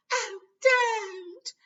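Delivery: high, loud wailing plea. A narrator's reading. Ginny wails at Fred teasing Harry, high and loud. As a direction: wail it in protest. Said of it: "she wailed".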